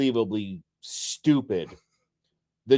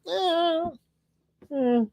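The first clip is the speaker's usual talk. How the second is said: very high, softened "eh" noises; dismissive whine; 3 dB quieter than usual. Acted. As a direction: squeak out a noncommittal eh. Mimicking his grumble at friends' complaints.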